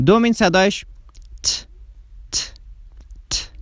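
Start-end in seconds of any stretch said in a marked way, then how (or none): 1.4-3.5 crisp voiceless "ت" repeated, no buzz; voiceless t sound. Shown as a demonstration. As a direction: tap out "ت" with air only. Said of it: "بدون لرزه هست".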